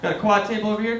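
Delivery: ordinary talk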